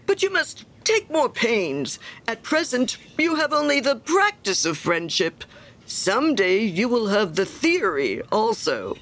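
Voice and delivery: high, emphatic lecturing with swooping pitch; self-important miller. Acted. Miller teaching Hans about friendship, condescending. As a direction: instruct a pupil condescendingly.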